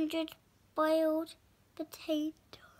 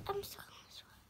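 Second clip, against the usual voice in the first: unvoiced whisper; 6 semitones higher, 15 dB quieter, much faster than usual. Giantess whispers to Jack, unvoiced. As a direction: breathe it out secretly.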